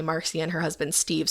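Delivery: ordinary talk, nothing marked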